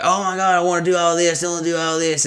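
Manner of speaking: high, monotone gushing imitation; mock eagerness. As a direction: imitate overeager people in a high voice. Mimicking eager people making promises.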